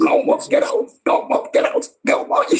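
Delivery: high squealed chant breaking into laughter; real laughing, high-pitched send-off; gleeful dismissal. Real, not acted. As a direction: crow it repeatedly, cracking up. Host gleefully kicks people out, laughing.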